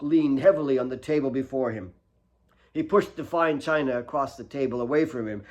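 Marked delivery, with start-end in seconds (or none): none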